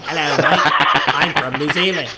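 hammed-up New Zealander greeting; New Zealand accent. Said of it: "that's a New Zealand accent".

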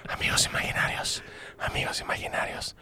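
breathy whispered echo of the title; hushed echo of the show name. Pretend.

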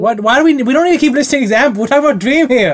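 loud, high, fast exasperated outburst; exasperated shout. Real, not acted. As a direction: snap at them to get back on topic. Scolding co-host to stay on topic.

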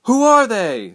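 high, loud alarmed shout. A narrator's reading. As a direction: shout the question up at a window. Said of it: "someone shouted up".

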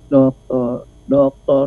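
"Dokter" said low and drawn out, proper form; teaching how "dokter" should sound; corrected pronunciation. Shown as a demonstration. Speaker correcting how people address her, low "Dokter". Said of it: "Bukan, dokter".

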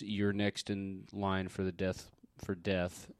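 hint of a ghost, drawn out slowly; ghostly voice. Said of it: "say in a ghostly voice".

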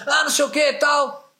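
high, loud mocking shout; macho shout. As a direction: mimic a tough guy shouting. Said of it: "todo machão".

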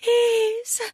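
high, drawn-out plea; begging. A narrator's reading. Woman pleading with her lover, begging. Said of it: "she begs".

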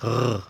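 a rough r scraped in the throat; r casi como los franceses; German throat r. Shown as a demonstration. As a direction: gargle the r at the back. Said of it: "se produce en la garganta".

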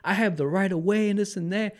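high-pitched indignant rant, not shouted; retold angry arguing. Teller replays standing up to the tall driver, mock indignant.

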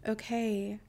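ordinary talk

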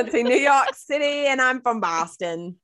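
loud, badly faked Boston accent; hammed-up Boston accent through laughter. Co-host bad at accents, giggly mock Boston accent. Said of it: "I'm bad at accents".

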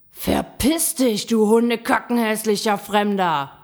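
angry yell. A narrator's reading. Gram drives the strange animal away, angry yelling. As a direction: yell it furiously. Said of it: "schrie der Gram das Tier an".

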